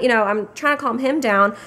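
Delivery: ordinary talk, nothing marked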